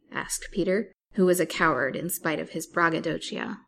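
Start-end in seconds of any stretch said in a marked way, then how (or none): none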